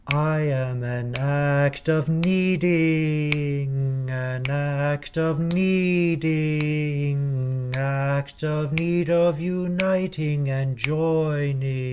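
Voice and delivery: slow, spaced rhythmic speaking; spoken under tempo. Shown as a demonstration. Choir director speaking the lyric slowly under tempo. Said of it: "sound spoken".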